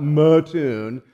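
MATTOON as muh-TOON, stretched out; outsiders' pronunciation. Shown as a demonstration. Telling what it's not, Mattoon as muh-TOON. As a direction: say Mattoon with a weak first vowel, muh-TOON. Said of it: "So yeah, they say Mattoon".

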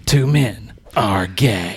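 low, slow trailer announcer; dramatic deep movie trailer voice. Acted. Parody of trailer voice.

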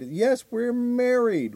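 mock stepmother. Retold stepmother answering cops.

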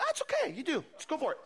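high, light brush-off of a threat; simple, unbothered reply. Acted. Prophets answering the king's threat, casually unbothered. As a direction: wave the threat away, starting high. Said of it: "response to the king was very simple".

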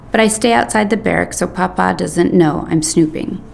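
ordinary delivery, nothing marked

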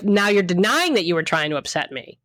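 normal talking voice

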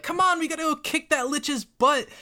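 put-on high, loud kid egging on a hero; jeering child. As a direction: jeer and urge in a high child voice. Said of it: "jeering, like, saying, like".

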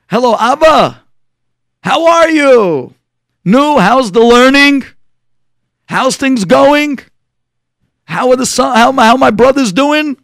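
butter Dad up with big, sing-out hellos; effusive new approach. Acted. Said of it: "he takes a different approach".

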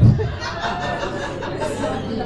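high breathless laugh. Real, not acted. Caught at the ATM, high laugh.

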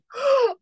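sharp high gasp of surprise; sudden high mock gasp of shock; imitated shocked gasp. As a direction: gasp like the sister seeing her present. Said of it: "She's like".

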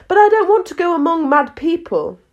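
put-on high, protesting girl's voice; Alice, protesting. A narrator's reading.

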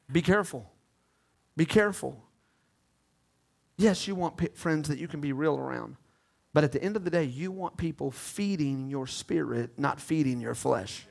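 low, soft word of caution; hushed preaching. Real, not acted. Preacher cautioning the congregation about friends, hushed. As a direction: drop right down, measured and low.

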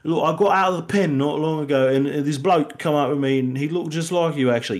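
put-on very high, over-the-top British accent; Helen Mirren British impression. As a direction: ham up a Cockney lady offering a lift. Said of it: "Helen Mirren's like".

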